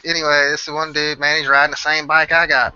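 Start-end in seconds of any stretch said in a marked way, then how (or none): none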